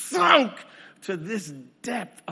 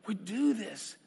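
usual speaking voice

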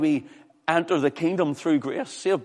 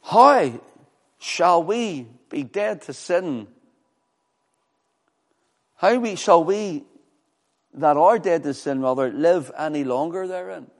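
forceful preaching; 7 dB louder than usual. Acted. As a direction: thunder the question from the pulpit.

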